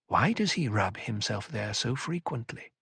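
usual speaking voice

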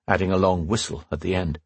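ordinary delivery, nothing marked

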